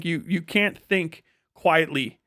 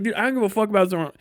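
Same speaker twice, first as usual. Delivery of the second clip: normal talking voice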